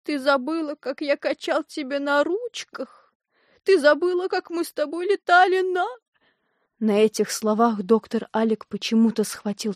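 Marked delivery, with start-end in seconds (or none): 0.0-5.9 whining plea. A narrator's reading. Impostor recalls fond memories to the girl, whining plea. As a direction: whine pleadingly, climbing higher. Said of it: "сказал толстяк плаксивым голосом".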